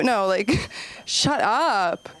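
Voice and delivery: ordinary talk, nothing marked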